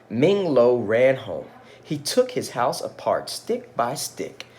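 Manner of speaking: put-on storytelling voice for children; gentle read-aloud storyteller; story narration. A narrator's reading. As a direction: read it like a picture book aloud.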